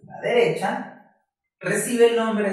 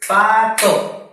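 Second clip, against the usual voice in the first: "Pato" loud and high, split pa, to; two voice beats; 4 semitones higher, 7 dB louder than usual. Shown as a demonstration. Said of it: "Lo dividimos en dos sílabas o golpes de voz". ("Pato" fuerte, en dos golpes de voz)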